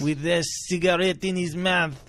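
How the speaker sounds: a light put-on French accent, slowed; hammed-up French accent telling his tale; thick French accent. Jean-Paul the French guy telling his story, light French accent. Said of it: "He spoke like this".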